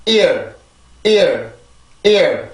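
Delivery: "ear" as one strong falling English syllable; stressed-syllable demo. Shown as a demonstration. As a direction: drill "ear" separately, short and falling. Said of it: "자, 해볼게요". (강세 음절 "ear" 발음 시범)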